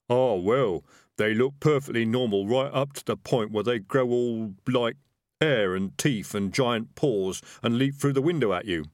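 lively, explaining character voice; animated rogue describing monsters; highwayman. Acted. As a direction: explain werewolves to a child, matter-of-fact.

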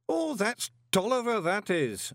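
put-on high, slow elderly man voice; a fond old man taking leave; old worshipful master. A narrator's reading. Old master saying goodbye to Moist, slow and high.

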